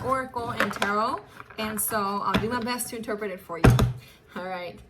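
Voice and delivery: usual speaking voice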